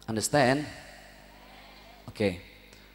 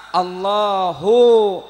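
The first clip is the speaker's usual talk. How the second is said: slow, loud drawn-out Allahu; drawn-out takbir; 3 semitones higher, 9 dB louder, much slower than usual. Shown as a demonstration. Preacher stretching the takbir for the congregation. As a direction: call it out long like a takbir. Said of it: "semua bacaannya sama".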